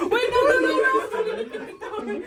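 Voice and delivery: high, loud laughing protest; laughing panic. Real, not acted. Door closing on friend.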